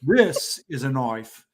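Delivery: slow, low Crocodile Dundee-style Australian line; mock Australian accent. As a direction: ham up an Australian movie quote, slowly. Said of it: "And I think my best is".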